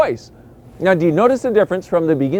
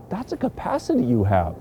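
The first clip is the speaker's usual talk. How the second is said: normal talking voice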